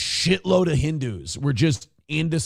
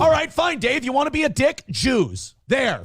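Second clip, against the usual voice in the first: high, exasperated yell; mock yell; 10 semitones higher than usual. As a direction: blurt it out exasperated. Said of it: "that's what I would've yelled at him".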